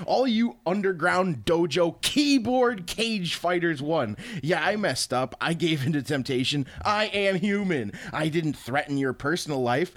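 mock-grand reading of an online rant; hammed-up, theatrical read-aloud; dramatic reading. Reads banned player's post aloud theatrically.

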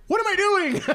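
sudden realization. Retold or imitated. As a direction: yelp at your own absurdity. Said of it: "I realize I'm like".

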